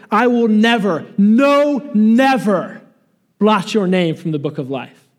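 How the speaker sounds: Jesus's raw forceful insistence on "never"; emphatic never. Retold or imitated. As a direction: hammer "no" hardest, then "never". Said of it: "It means it's emphatic".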